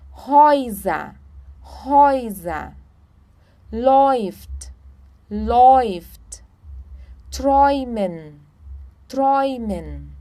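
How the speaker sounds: "Häuser", "Läuft", "Träumen" said slowly with an OI sound; OI diphthong. Shown as a demonstration. Teacher giving German examples, OI in "Häuser", "Läuft", "Träumen". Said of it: "então também oi".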